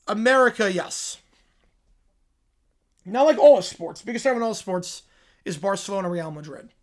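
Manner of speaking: usual speaking voice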